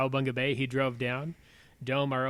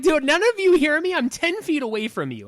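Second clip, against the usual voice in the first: much higher and louder, fed-up shouting; exasperated yell; 10 dB louder than usual. Retold or imitated. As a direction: yell at deaf lifeguards in disbelief. Retold yelling at lifeguards.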